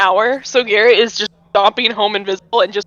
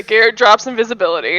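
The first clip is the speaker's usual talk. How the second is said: ordinary delivery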